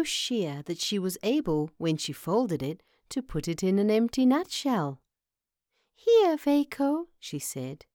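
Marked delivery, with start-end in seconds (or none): 5.9-7.1 slow, high-pitched mouse voice; sweet little mouse. Acted. Mouse hands Vaco her weaving, sweetly presenting. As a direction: offer it in a high, drawn-out mouse voice.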